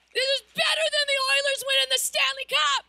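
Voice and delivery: excited shout. Real, not acted. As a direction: fire the crowd up like a hockey win. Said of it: "you need to get just a little bit excited".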